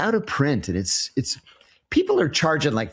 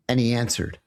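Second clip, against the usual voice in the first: ordinary talk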